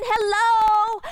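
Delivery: loud, high call into the dark; God calling out. Acted. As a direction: call out into the dark to find someone. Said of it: "it's saying".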